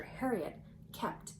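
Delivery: usual speaking voice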